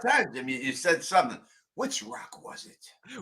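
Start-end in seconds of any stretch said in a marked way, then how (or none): 1.8-3.2 mocking whisper. Mocking Jim's question.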